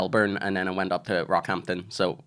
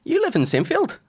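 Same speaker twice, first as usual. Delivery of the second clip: much higher, astonished question; surprised question; 3 dB louder than usual. Retold or imitated. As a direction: act out the stranger's shock of recognition. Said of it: "And they go, like".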